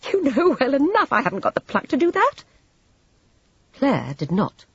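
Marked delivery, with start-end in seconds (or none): 0.1-2.4 high, loud, sharp retort; scornful, higher-pitched admission; sharp laugh. A narrator's reading. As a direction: throw it back bitterly after a short laugh. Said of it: "Vivian laughed sharply".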